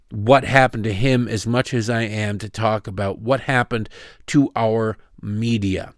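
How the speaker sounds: normal talking voice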